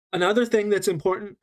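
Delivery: ordinary talk, nothing marked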